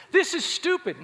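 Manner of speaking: loud, high imitated yell; mock shout. Steve yelling back in the argument, loud mock shout.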